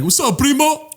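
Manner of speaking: high, drawn-out imitation of a greeting; hearty vendor greeting. Imitating the guy at the taco stand, hearty greeting. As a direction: hail a customer in a put-on high voice.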